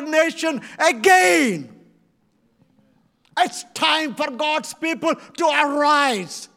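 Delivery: rally the crowd, booming and urgent; preaching at full force. Acted. Preacher rising to a call.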